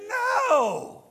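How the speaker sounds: firm no. Retold or imitated. Jesus refusing the disciples, loud firm no. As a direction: cut them off with one loud word.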